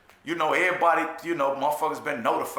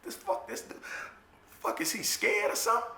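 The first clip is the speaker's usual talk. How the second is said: high, breathy, whispered mockery; mocking scared voice; 7 semitones higher, 8 dB quieter than usual. Mocking a scared cellmate, in a high hushed voice. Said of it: "I'm like".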